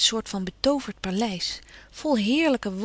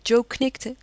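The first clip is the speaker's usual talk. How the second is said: ordinary delivery, nothing marked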